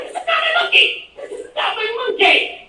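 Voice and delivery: high-pitched laughing exasperation; laughing high disbelief. Retold or imitated. Telling the hotel man it is not his problem, laughing high. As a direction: squeal it through laughter, refusing the job. Laughing retort to the bear advice.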